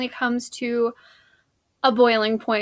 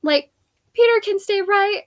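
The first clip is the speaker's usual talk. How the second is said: put-on eager pleading girl; 7 semitones higher than usual. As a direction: burst in begging Mom for Peter. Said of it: "Anne bursts in".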